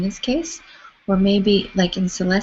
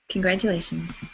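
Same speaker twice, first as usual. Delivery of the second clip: ordinary talk, nothing marked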